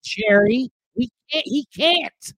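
angry nephew at the dinner table; heated family retort. Acted. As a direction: stand up to the uncle. Arguing with uncle Jerry.

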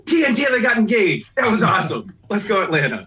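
high, loud excited cheering. Retold or imitated. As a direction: cheer it like a thrilled fan. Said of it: "I was like".

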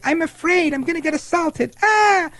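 high mock-frightened squeal; mocking AOC. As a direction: lampoon her as a panicky victim, ending in a shriek. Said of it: "she just says things like".

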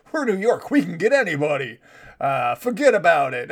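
mocking brash New York accent; cocky Noo Yawk swagger, played for laughs. Mocking New York media in a hammed-up New York accent. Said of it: "I'm really good at New York accents".

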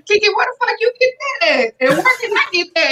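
high, excited begging for the shirt; eager fan. Retold or imitated. Neighbor eagerly begs Kiki for the shirt, excited high voice. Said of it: "Man, I was getting".

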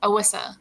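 L of "Alyssa" turned into W; "Alyssa" as a small child's "Awissa"; childhood mispronunciation. Shown as a demonstration. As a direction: say "Alyssa" with a W sound for the L. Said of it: "hard time with L's and Y's".

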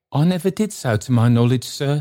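quick, high-pitched humble protest; flustered Trotty. Acted. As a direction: protest your innocence fast and high.

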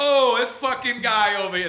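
high mock-Italian griping; exaggerated put-on Italian accent. Joking about yelling at cooks in an Italian accent. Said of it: "doing an Italian accent".

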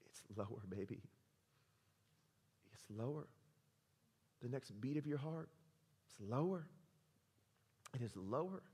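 quietly affirming to the congregation; hushed answers. Real, not acted. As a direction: say it almost under breath.

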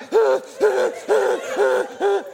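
loud high-pitched burst of laughter; cracking up loudly mid-sermon. Real, not acted. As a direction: let out a big high laugh. Laughing after the quote.

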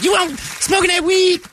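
put-on very high nagging girlfriend; shrill suspicious girlfriend question.

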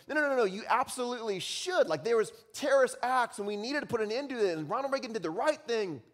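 high, insistent rebuttal; arguing student. Acted. Student defends Reagan to classmates, heated high rebuttal. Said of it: "over here on this side of the room".

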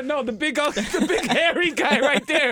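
high, slow laughing mock mom. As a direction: point him out in a high mocking voice. Playing the mother pointing out son.

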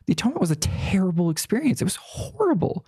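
high, quickening emphasis on "terrible" and "horrible"; emphatic insistence. Real, not acted. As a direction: punch "terrible", then leap high on "horrible". Insisting on how awful it was.

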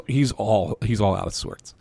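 usual speaking voice

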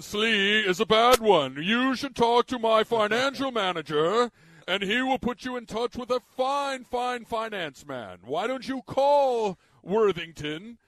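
slow, loud, waspy rich-guy drawl; Northeast rich guy. Acted. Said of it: "like, Northeast rich guy thing".

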